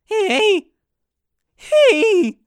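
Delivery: high, loud adolescent squawk; cracky-voiced yell. Comic yelling at choir bullies with a cracky voice, squawked high. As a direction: yell in a cracking pre-puberty voice. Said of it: "yell at them with my cracky voice".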